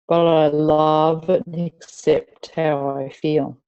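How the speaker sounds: "love and accept" said slowly and evenly; slow acceptance. Shown as a demonstration. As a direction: ease into "But I love and accept how I feel" slowly, settling. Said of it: "repeat these after me".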